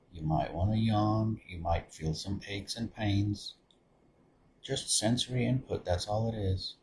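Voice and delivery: soft, low-key meditation voice with long pauses; guided meditation. Acted. Meditation leader settling listeners into open awareness, guided meditation. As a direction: guide them gently inward, softly, leaving space between phrases.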